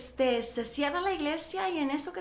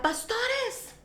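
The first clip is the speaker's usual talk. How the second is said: mild mock shout, pitched way up; imitated calling out; 9 semitones higher than usual. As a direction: mimic someone hailing from behind. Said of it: "alguien nos grita".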